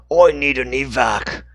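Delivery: the wrong voice for Dixon. Acted. Dixon calls for evac, but in the wrong voice. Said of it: "wrong voice again".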